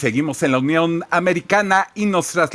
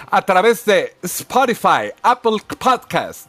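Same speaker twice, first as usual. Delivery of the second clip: ordinary talk, nothing marked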